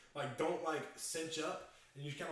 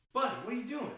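put-on higher and louder, calling after a kid down the hall; 7 semitones higher, 6 dB louder than usual. Dad calling after his sleepwalking son, louder and higher. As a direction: call out to him sharper the second time. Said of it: "Sean was like".

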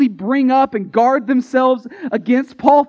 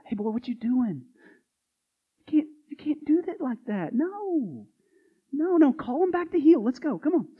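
hushed, higher scolding aside, a hint of it; 5 semitones higher, 8 dB quieter than usual. Preacher pulling Paul aside, hushed mock scold. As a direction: pull him aside and scold him quietly. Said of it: "pull Paul aside and go".

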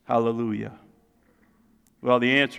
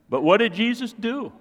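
ordinary talk, nothing marked

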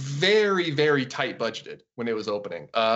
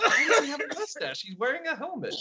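laughing disbelief; 13 semitones higher than usual. Retold or imitated. Retold own reaction, laughing.

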